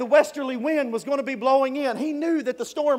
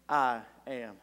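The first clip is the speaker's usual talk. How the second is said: low, grave declaration; solemn Jesus; 11 semitones lower, 8 dB quieter than usual. Acted. Jesus answers Peter from the water, lower and solemn. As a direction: speak it like divine authority. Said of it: "Jesus says".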